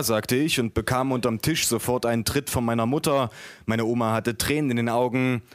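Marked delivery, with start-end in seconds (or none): none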